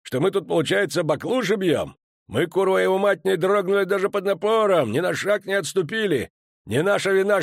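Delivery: put-on dwarf commander rages at young officer; furious dwarf. A narrator's reading. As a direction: rage at the insult to his troops. Enraged dwarf berates young officer.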